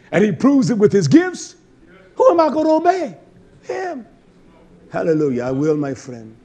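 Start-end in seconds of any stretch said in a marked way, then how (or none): 0.1-4.1 loud, climbing to a high shout; full-force preaching. Real, not acted. Preacher defends his blunt talk to the congregation, full-force preaching. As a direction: throw out a high, loud challenge.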